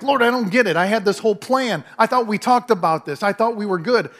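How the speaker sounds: praying to God in frustration, complaining loudly; frustrated complaint. Retold or imitated. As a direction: vent at God like a let-down friend. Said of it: "and I'm like".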